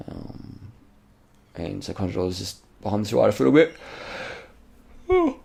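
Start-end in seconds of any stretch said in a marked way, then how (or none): 3.3-5.4 talking through a real yawn. Real, not acted. Commentator breaks off mid-race remark, yawning. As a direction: let a yawn stretch the last words. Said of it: "Quick yawn break".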